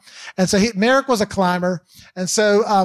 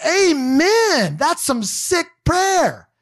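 excited amen; 9 semitones higher, much slower than usual. Retold or imitated. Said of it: "So I'm like".

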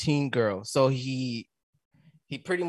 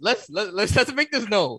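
laughing; 9 semitones higher, 4 dB louder than usual. Real, not acted. Composing himself through laughter.

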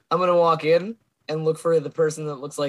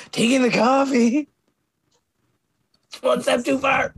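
rising fury; 9 semitones higher, slower than usual. Acted. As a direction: let the anger boil over. Rage builds after whisper.

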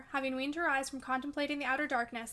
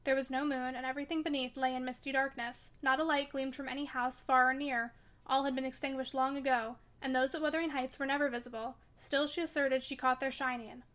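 usual speaking voice

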